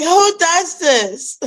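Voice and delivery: high, slow, incredulous cry; high drawn-out who does this; mock outrage. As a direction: throw up your hands at the sky. Retelling own outrage at rain.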